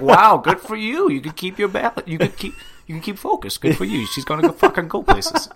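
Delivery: mock-cheery high voice, laughing; sarcastic high praise. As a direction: gush over it sarcastically. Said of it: "And he's like".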